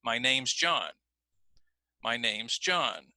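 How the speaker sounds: "John" carries the main pitch change, rising then falling; focus on John. Shown as a demonstration. Teacher shows class focus word stress. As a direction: lean on "John" and let the voice drop. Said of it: "the word John suddenly becomes the most important".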